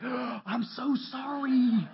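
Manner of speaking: mock apology. Man caught joking apologizes to pastor, slow aghast sorry. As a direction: gasp then plead sorry, wide-eyed.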